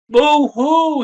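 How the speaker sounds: high, loud pretend sobbing boo-hoo; a little beaver crying out loud; mock crying boo-hoo; storybook boo-hoo. A narrator's reading. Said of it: "he began to cry".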